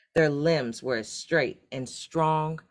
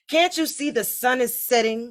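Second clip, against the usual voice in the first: higher, louder exasperated shout; 9 semitones higher, 5 dB louder than usual. A narrator's reading. Sixth son shouting at his arguing brothers, exasperated shout. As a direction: yell it at your brothers. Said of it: "shouted the sixth son".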